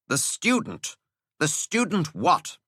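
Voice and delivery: irritated schoolmaster; dry impatient teacher. Acted. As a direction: needle the breathless boy.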